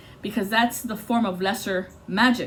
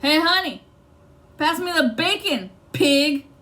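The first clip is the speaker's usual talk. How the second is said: higher and louder, smug punchline; mocking jerk; 6 semitones higher, 4 dB louder than usual. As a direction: sneer the insult as a joke. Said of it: "The other guy was like".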